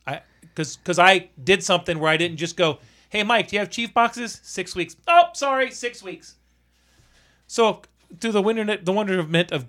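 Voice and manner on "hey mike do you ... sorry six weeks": high, put-on helpless voice; mocking imitation. Mimicking someone asking Mike for boxes and giving up, mocking. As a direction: mimic a useless phone enquiry, pitched up. Mocking a half-hearted supplier phone call.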